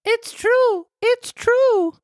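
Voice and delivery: put-on high boyish voice, tearful; a puppet boy pleading in tears; Pinocchio crying. Pinocchio caught, crying to the farmer. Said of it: "cried Pinocchio in tears".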